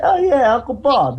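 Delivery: high, breezy quote of Uncle Bob; mock casual uncle quote.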